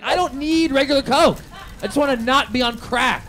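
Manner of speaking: high, loud frantic protest; acted-out panicked refusal; freaking out. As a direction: plead loudly in a high panic. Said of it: "I'm really having a freak out".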